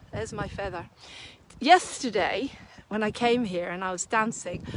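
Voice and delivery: usual speaking voice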